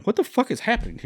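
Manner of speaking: high, fast mock bafflement; baffled reader. Anyone reading the script, fast baffled outburst. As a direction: rattle it off in disbelief. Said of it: "would have looked at it and gone".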